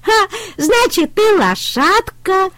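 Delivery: very high squeaky duckling voice; ducklings teasing. A narrator's reading. Утята дразнят трактора, очень высоким голоском. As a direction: chirp it like a tiny duckling. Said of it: "сказали утята".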